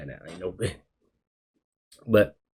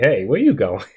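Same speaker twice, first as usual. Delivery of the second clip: calling out; 5 semitones higher, 6 dB louder than usual. Retold or imitated. Brother catches him leaving.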